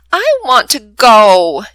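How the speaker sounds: pouty plea to be allowed along; pouting. Acted. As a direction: drag it out like a sulking child. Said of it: "Jimmy pouts".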